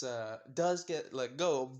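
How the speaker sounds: plain reading voice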